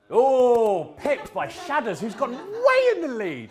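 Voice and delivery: loud, high and slow quiz-host call; excited announcement. Real, not acted. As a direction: hype up the room about the leader. Announcing quiz leader to room.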